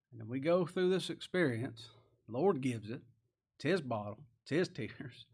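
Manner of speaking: ordinary delivery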